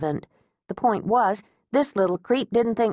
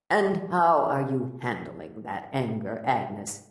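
a lightly lowered, soft doctor's voice; gentle therapist asking, a bit softer; Doctor Garvin gently probing, softer and lower; calm therapist; 6 semitones lower, 4 dB quieter, slower than usual. A narrator's reading.

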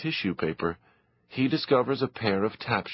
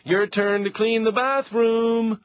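teasing shout; 12 semitones higher than usual. Acted. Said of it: "Pietro shouts".

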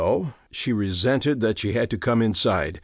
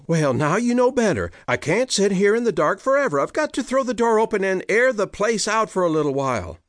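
fast defiant retort; 8 semitones higher, faster than usual. Acted. Hannah retorting to Peter.

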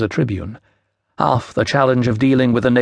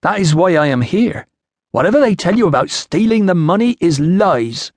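put-on higher, emphatic Iceni-accented voice; foreign Iceni accent; 7 semitones higher, 3 dB louder than usual. A narrator's reading. Woman tells the official why she came, insistent Iceni accent. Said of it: "trouble following your accent".

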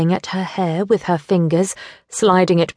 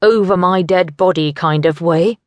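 usual speaking voice

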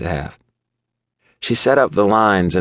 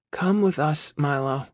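much higher, softer, gentle plea; soft asking; 8 semitones higher, 7 dB quieter than usual. A narrator's reading. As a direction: coax him softly, high and hushed. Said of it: "his girl asked".

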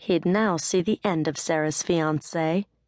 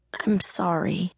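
strangled whisper; slower than usual. A narrator's reading. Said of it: "in a strangled whisper".

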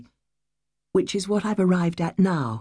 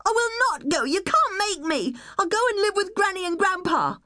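put-on defiant child. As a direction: dig in like a child refusing to move. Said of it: "she said".

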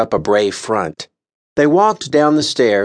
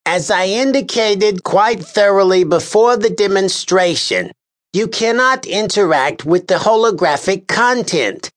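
high, officious, exasperated voice; pompous official scolding a juror; exasperated Lars Kern; 10 semitones higher than usual. A narrator's reading. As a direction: lecture the juror with weary disdain. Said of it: "began the exasperated Lars Kern".

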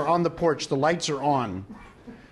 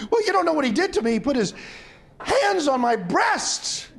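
put-on higher-pitched, worked-up young woman in a joke; mock distressed complaint; 7 semitones higher than usual. Upset young woman complains to her priest, mock distressed. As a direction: protest in hammed-up distress, drawing out the last words. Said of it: "Young lady's crying".

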